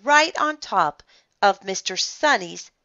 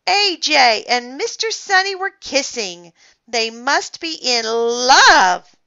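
higher, schoolkid taunt; teasing; 6 semitones higher, 6 dB louder than usual. A narrator's reading. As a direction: tease them, stretching and punching the final word. Kid teases about a supposed kiss.

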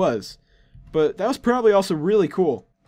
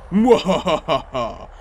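evil villain laugh; much slower than usual. Acted. Boss laughs after threat.

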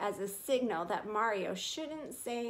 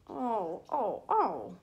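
moaning; much slower than usual. Acted. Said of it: "Tucker moaned".

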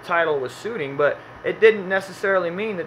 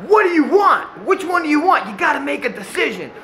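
much higher and louder exasperated yell; impatient dad yelling; 11 semitones higher, 5 dB louder than usual. Retold or imitated. Dad losing patience with his son at the printing booth, yelling. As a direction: snap at the kid who can't decide. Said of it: "the whole line hears the dad".

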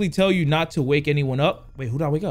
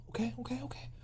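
whisper; 7 semitones higher, 17 dB quieter, faster than usual. Real, not acted.

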